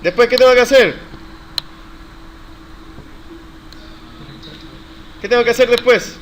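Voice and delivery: loud, high-pitched prompting question; quizzing the class. Real, not acted. Teacher quizzes students on the next step, loudly prompting.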